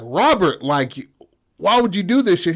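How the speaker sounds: high, loud accusing yell; mock scold him for the dead cat. Staff confront Robert, loud accusing yell.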